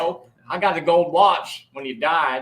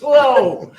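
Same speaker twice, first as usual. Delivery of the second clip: hammed-up guffaw; 5 semitones higher, 6 dB louder than usual. Laughing at dad joke.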